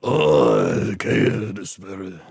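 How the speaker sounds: dramatic low voice. Acted. Reacts in skit, much lower.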